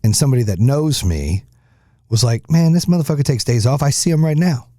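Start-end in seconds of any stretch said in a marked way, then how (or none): 2.5-4.7 high, mocking ribbing voice; teasing buddy. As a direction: rib him like a buddy. Friend teasing about days off.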